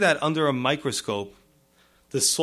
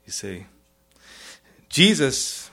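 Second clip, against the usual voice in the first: normal talking voice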